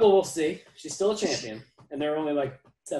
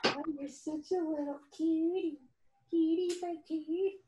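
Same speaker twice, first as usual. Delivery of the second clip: high cooing voice to puppy. Real, not acted. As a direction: coo high and slow at the pup.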